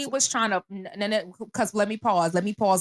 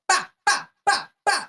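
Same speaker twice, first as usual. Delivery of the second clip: spaced, high-pitched "bah" hits; mock punch sounds; 8 semitones higher than usual. Teller acting out punches on the girl, mock impact sounds. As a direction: punctuate each imagined punch with a high bah. Imitating punches she imagined landing.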